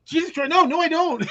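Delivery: laughing as he plays the shrink guy recoiling; horrified denial. Retold or imitated. Retelling the sketch to friends, a high laughing protest. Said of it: "He's like".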